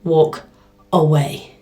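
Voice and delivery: low, steady and deliberate; calm firm command. Retold or imitated. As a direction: say it slowly like a final warning. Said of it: "I was like".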